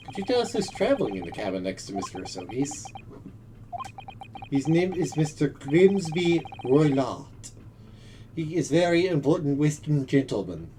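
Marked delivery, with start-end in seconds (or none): 4.5-10.7 put-on Russian accent. Plays Russian sailor.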